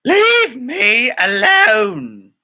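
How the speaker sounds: high, loud, slow shouted title; drawn-out dramatic yell; mock shout. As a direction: belt out the title.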